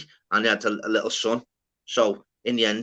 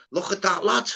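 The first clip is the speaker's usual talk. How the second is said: high, put-on Scouse accent, trying too hard; Wool faking Scouse; 7 semitones higher than usual. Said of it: "He's like".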